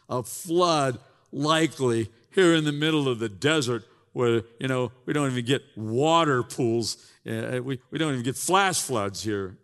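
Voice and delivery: loud incredulous ridicule; mock the very idea of a flood; scoffing townsman.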